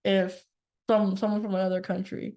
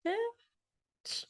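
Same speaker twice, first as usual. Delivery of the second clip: breezy bitch; 8 dB quieter than usual. Retold or imitated. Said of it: "I'm like".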